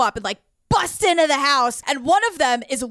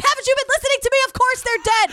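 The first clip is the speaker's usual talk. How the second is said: mock exasperated yell, much higher; 10 semitones higher, much faster than usual. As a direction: imitate a frantic guy losing patience. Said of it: "And the dude's like".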